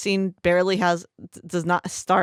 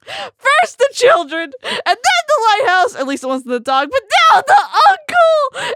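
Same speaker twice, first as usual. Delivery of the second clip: laughing; 7 dB louder than usual. Real, not acted. Host mimicking the friend's grief list, laughing. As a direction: wail it through laughter. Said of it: "she's just like".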